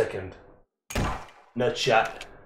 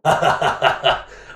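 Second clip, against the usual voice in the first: airy unvoiced chuckle; a quick breathless laugh; breathy laugh; 3 dB louder than usual. Real, not acted. Laughs after winning.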